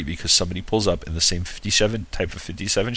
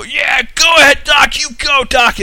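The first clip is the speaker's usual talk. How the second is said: much higher, louder mock cheer; mock cheering shout; 8 dB louder than usual. Frank shouting after Doc as he roars off, a hammed-up much higher cheer. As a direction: yell it after the car like a cheesy send-off. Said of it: "you hear Frank go".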